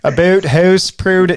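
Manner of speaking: hammed-up Canadian OO vowels, loud and drawn out; Canadian accent. Shown as a demonstration. Canadian host demonstrating for his guest, exaggerated Canadian accent. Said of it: "if it was a Canadian accent".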